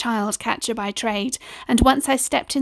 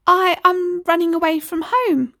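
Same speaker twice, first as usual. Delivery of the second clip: lightly voiced small boy, higher; a little boy declaring he's leaving; 8 semitones higher, 3 dB louder than usual. Boy tells Missus Cole he's running away, lightly childlike. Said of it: "I said".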